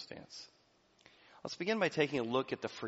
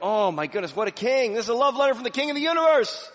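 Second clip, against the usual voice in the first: high, loud, fast gushing; mock awe; 12 semitones higher, 10 dB louder, faster than usual. Imagined crowd adoring the king, high and gushing. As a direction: gush with hammed-up amazement. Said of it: "You think people are like".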